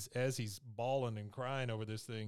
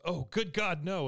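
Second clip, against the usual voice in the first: high, loud cry of dismay; horrified cry; 7 semitones higher, 7 dB louder than usual. Retold or imitated. Mom seeing the stage catch fire, loud horrified cry. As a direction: let it burst out in panic. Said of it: "and she goes".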